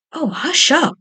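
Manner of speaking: high scolding hush. A narrator's reading. Pumpkin hushing Potato, mildly scolding. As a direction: shush him sharply. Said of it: "cried Pumpkin".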